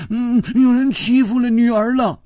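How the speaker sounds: high, whining daughterly sob; tearful voice. A narrator's reading. Daughter telling her father she was bullied, tearful plea. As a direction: complain in a high crying whine. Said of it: "带着哭音说".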